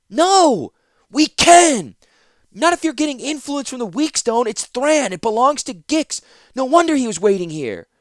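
put-on high, eager disbelieving young man; Ratapi's young voice. Ratapi urging Zancha to see time changing, high and eager. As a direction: press her, amazed she can't see it.

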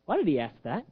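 ordinary talk

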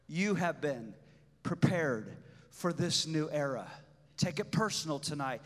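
quiet, intimate pastoral voice; soft encouragement. Acted. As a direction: speak gently to one listener.

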